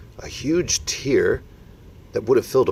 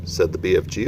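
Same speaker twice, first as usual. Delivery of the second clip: plain reading voice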